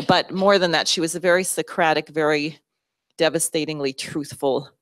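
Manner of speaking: ordinary talk, nothing marked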